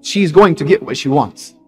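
normal talking voice

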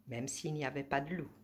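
ordinary delivery

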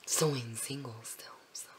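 soft whispered confession. Real, not acted. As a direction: whisper it shyly. Whispered aside.

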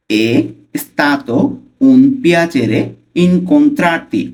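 slow, word by word with pauses; slow pronunciation. Shown as a demonstration. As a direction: stretch out each Italian word for learners. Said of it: "slowly উচ্চারণ করবো ফার্স্টে". (ধীরে ধীরে উচ্চারণ)

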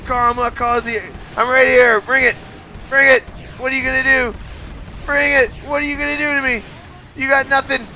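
high, loud taunting yell; shouted dare at a kaiju. Real, not acted. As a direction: dare the monster to come at you. Taunting a giant monster in game.

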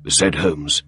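ordinary delivery

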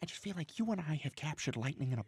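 hushed, low private whisper; confidential whisper. Acted. Writer confiding in partner in the kitchen, whispering. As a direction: whisper it so the other room can't hear.

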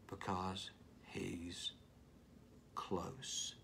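slow, hushed whisper; slow whispered close. Shown as a demonstration. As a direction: lean in and whisper. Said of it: "He whispers".

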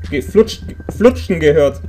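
usual speaking voice

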